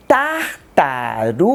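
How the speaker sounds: slow "tartaruga" split into tar-ta-ru-ga; teacher spacing out "tartaruga" into its four syllables for children. Shown as a demonstration. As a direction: say "tartaruga" slowly with a clear gap after each syllable. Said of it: "pronunciar essa palavra dando espaço entre as sílabas".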